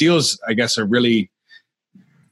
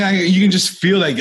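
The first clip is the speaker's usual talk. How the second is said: ordinary talk, nothing marked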